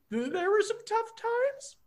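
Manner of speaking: high awkward voice. Pretend. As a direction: pitch it way up, sheepish and awkward. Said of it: "As I use my very high, awkward voice".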